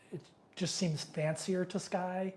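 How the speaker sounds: usual speaking voice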